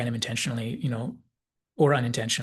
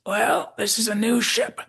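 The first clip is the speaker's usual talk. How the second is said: put-on fond old Southerner giving advice, pitched up; Southern McCoy; 10 semitones higher, 5 dB louder than usual. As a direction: counsel the youngster in a high Southern accent. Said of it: "while McCoy says".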